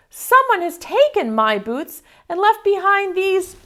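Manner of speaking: high, a mild cub's wail; whiny wail. Acted. Baby Bear missing her boots, mild high wail. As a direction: whine it like a little bear who lost her boots. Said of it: "she wailed".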